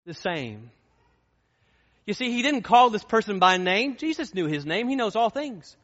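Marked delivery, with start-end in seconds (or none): 2.1-5.8 raised, loud preacher's fervor; fiery pulpit delivery; full-force preaching. Acted. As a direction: preach it out, high and hard.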